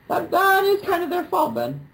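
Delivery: high mock protest; facetious high-pitched protest; mock serious. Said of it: "I'm not serious".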